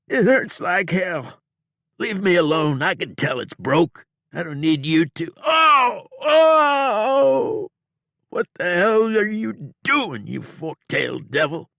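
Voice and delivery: high, loud pained protest breaking into cries; wounded outlaw yelling in agony, high and loud; hurting outlaw. A narrator's reading. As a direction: plead and cry out in pain. Bob in pain, protesting.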